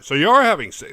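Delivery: high and loud, pushing hard; loud pushy question. Retold or imitated. Cop pressing the girlfriend, loud and pushy. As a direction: blurt the accusation at her.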